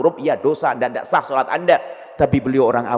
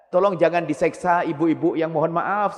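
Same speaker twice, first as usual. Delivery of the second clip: ordinary delivery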